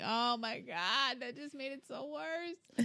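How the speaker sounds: high, slow, hushed dismay; small retold moan of regret; dismayed self-reproach. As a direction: drag it out softly, pitch creeping up. Retelling dismay at own blunder.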